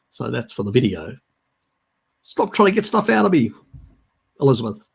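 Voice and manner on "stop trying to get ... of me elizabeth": raised, louder teasing protest; playful telling-off, high and loud; mock scolding. Adviser teasing a questioner, mock scolding.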